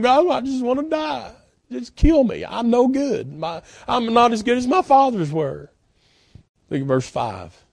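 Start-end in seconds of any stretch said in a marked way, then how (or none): 0.0-5.7 higher-pitched, plaintive prayer of defeat; depresso e sconfitto. Acted. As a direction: plead with God as a man giving up. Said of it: "He is so depressed and so down about it all".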